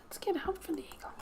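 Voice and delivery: usual speaking voice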